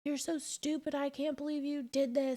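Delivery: a hint of a father berating his son; retold angry outburst, understated; mild mock yell. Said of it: "starts screaming bloody murder".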